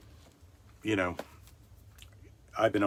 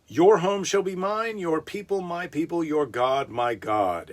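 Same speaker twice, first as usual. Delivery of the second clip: higher, grand biblical declaration; solemn vow; 6 semitones higher than usual. Acted. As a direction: declare it like scripture.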